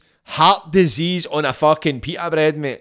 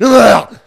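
loud fed-up groan; loud disgusted grunt of frustration; frustrated groan; 8 semitones higher, 12 dB louder than usual. Acted. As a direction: let out a big exasperated ugh. Fed-up groan after rant.

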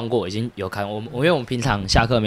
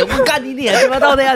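much higher, laughing fake tough yell; laughing mock rage; 14 semitones higher than usual. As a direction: ham up the hammer threat through giggles. Hammer bluff, fake rage. (笑着假装暴怒)

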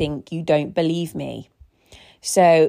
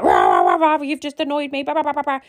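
mock ranting; 8 semitones higher, faster than usual. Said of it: "instead of talking to them about it".

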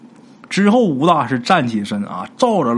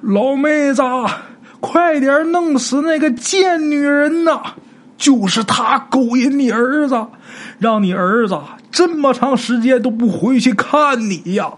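very high, slow, shrill incitement; screaming ghost old man; 10 semitones higher, slower than usual. Acted. Old ghost yelling at the ghost mother to kill the woman. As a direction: howl it out to egg her on. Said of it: "立马就喊".

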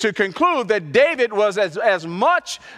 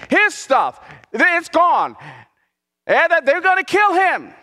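rising, louder preaching build; 3 semitones higher, 7 dB louder than usual. Acted. Pastor telling the congregation of David's loss, preaching louder and higher.

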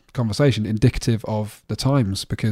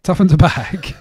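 laughing; 6 semitones higher, slower than usual. Real, not acted. Echo with laugh.

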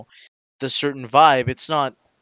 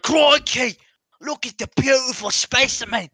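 higher, put-on Australian wildlife-man accent; Steve Irwin impression; 5 semitones higher than usual. Co-host admiring a beast, mock Australian accent. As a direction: marvel in a hammed-up Australian accent. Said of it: "St-, Steve Irwin".

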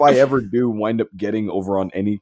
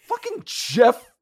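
incredulous; 13 semitones higher, slower than usual. Real, not acted. Disbelief at being followed.

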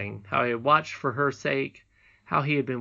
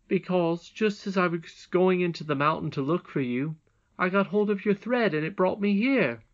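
lightly voiced boy, raised pitch; a touch of eager explaining; earnest boy; 6 semitones higher, 3 dB louder than usual. A narrator's reading. Curdie explaining to the princess, lightly voiced boy.

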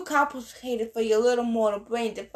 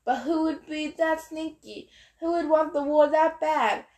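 mystified; 5 semitones higher than usual. A narrator's reading.

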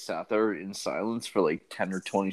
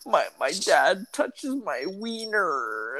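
high, drawn-out mock sobbing; fake crying; 8 semitones higher, much slower than usual. Prank-calling kid to a helpline lady, pretend crying. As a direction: imitate a kid's badly faked tearful whine. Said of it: "pretending to cry".

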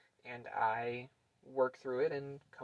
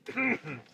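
throat clearing; 7 semitones higher, 5 dB louder than usual. Real, not acted. After choking on a drink.

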